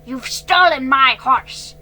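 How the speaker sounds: loud, slow angry rant; angry scolding. A narrator's reading. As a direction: scold the thief furiously. Angry owner scolding the girl.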